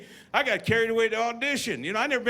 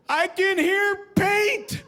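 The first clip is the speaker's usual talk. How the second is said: much higher, slow, dazed and amazed; tripping awe; 11 semitones higher, 4 dB louder, much slower than usual. Retold or imitated. Freshman on acid, awed and much higher. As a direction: hear the wonder of a first trip.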